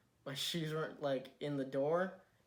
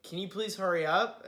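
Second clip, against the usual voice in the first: raised, louder mock nagging; impatient demand; 5 semitones higher, 7 dB louder than usual. Friend hurries the latecomer into recording, impatient. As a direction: hurry them along, exasperated. Retells Gage hurrying him.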